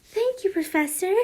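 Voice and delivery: put-on high, cutesy anime-girl thanks. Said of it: "just like an anime girl".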